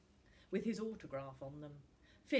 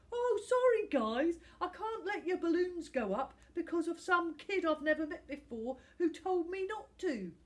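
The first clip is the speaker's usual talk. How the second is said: high, laughing mock apology; mocking Tom; 11 semitones higher, 10 dB louder than usual. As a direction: lampoon the star's excuse. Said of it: "and Tom says".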